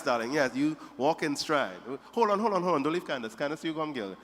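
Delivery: normal talking voice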